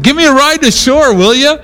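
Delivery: high, carrying call to the boat; hailing the boat, pitched well up. Retold or imitated. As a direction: shout the request across the water. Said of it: "and I yelled at them".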